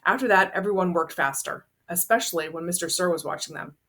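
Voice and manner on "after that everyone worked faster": ordinary delivery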